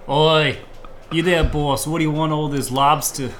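brash dock worker hollering at the rich; a poor fisherman heckling the fancy crowd, brash and loud; rough fisherman. Acted. Plays lobster fisherman.

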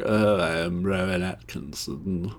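slow, drawn-out British impression; mock English actor voice; Rowan Atkinson. Shown as a demonstration. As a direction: stretch the words like a hesitant Englishman. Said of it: "who can do the best Rowan Atkinson impersonation".

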